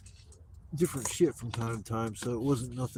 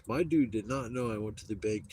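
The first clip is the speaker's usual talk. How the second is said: ordinary talk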